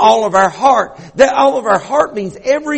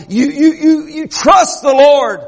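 rising preaching; 7 semitones higher than usual. Real, not acted. Preacher building to climax.

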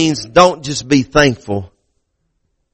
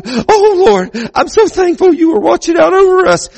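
retelling wife's relieved tearful prayer, pitched much higher; 13 semitones higher than usual. Retold or imitated. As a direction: imitate a wife crying out her thanks. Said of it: "she starts crying".

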